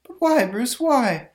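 put-on high, pleading girlfriend voice. [name] pleads.

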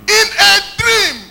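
high, slow shouted repeat; loud preacher's echo, drawn out; emphatic scripture echo. Real, not acted. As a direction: proclaim it loud to the congregation. Preacher echoes reader to congregation.

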